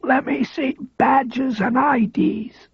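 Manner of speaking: high, slow, breathy whisper of a frail man; frail man's high whispered demand. A narrator's reading.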